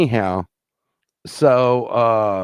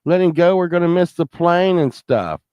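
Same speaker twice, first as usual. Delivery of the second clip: higher, louder urging; pleading call; 8 semitones higher, 4 dB louder than usual. Retold or imitated. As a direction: call out to hurry them along. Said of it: "I'm like".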